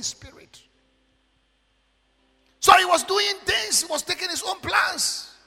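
high, shouted sermon; loud, emphatic proclamation with pauses; full-force preaching. Real, not acted. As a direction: drive it home to the congregation at full voice.

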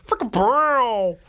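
high, loud, slow jokey "brain"; mock silly voice. Friend mocking the catchphrase. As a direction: ham up the catchphrase.

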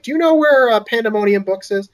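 eager question. Retold or imitated. Agent asking the writer where the bookstore is, lifted high. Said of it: "and he's like".

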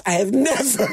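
laughing through the words; can't hold back the laugh; breaking into real laughter; laughing in disbelief. Real, not acted.

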